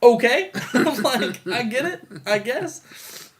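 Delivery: high, questioning concession; doubtful acceptance. Retold or imitated. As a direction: go along with it, unconvinced, half laughing. Acting viewer's doubtful acceptance.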